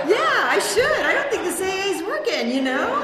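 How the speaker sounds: loud, high mock agreement; sarcastic. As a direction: laugh it off sarcastically. Sarcastic reply to husband.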